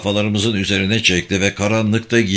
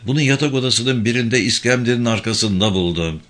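plain reading voice

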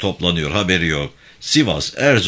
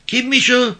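put-on high calling voice of an old woman; 5 dB louder than usual. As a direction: call out from the doorway, high. Mother calls from the door.

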